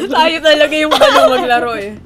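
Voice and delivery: laughing. Real, not acted. Laughing at the joke.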